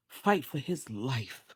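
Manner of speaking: ordinary delivery, nothing marked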